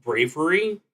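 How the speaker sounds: normal talking voice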